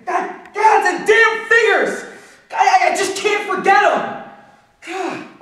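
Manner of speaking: high, stammering shout of torment; anguished outburst. Acted. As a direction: cry out as if the numbers won't let go. Breaks down over the figures.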